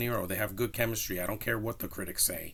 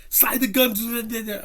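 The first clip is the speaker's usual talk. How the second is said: mock German-ish accent, much higher and louder, slurring into gibberish; garbled foreign villain accent; 12 semitones higher, 7 dB louder, slower than usual. Reviewer repeating the villain's unintelligible line, mock foreign accent. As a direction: stretch it out in an exaggerated, muddled Spanish-German accent. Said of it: "what, what is that accent".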